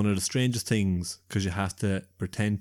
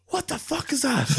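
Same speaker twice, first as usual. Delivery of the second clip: high shocked cry after a knee; kneed awake in bed, crying out; startled outcry. Retold or imitated. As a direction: yelp it in sudden pain and shock.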